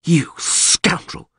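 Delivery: slow, breath-heavy hiss; hissed insult. A narrator's reading. Bound lord insults the witcher, hissing. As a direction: hiss it with venom. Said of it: "hissed Ostrit".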